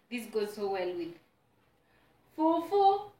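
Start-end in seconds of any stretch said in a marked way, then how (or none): 2.4-3.1 high delighted squeal. Real, not acted. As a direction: sing out the food's name. Savouring food name.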